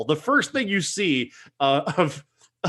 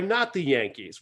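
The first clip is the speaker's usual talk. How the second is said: usual speaking voice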